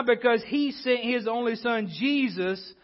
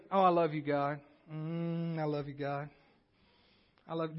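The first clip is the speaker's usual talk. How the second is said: soft, low, half-hearted murmur; hushed imitation of easy devotion; mock-sentimental devotion; 6 semitones lower, 10 dB quieter than usual. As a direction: murmur it low, as a token profession of love. Mimics lukewarm professions of love.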